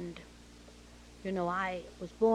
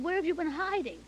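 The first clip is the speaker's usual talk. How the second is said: high, louder mock-scolding question; retold exclamation; 9 semitones higher, 6 dB louder than usual. Director finding little Betty after searching, exclaiming. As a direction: exclaim it high, as to someone long lost.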